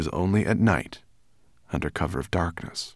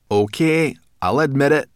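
higher, louder, brash little voice; brownie girl; 7 semitones higher, 7 dB louder than usual. A narrator's reading. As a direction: admit it loudly and grudgingly. Brownie girl grudgingly admits.

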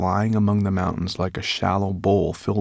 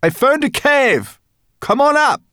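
calling out; 9 dB louder than usual. A narrator's reading. Sorrel found a cave, calling down. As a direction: holler down from far above. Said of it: "she suddenly called down to the others".

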